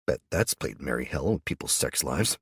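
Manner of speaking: usual speaking voice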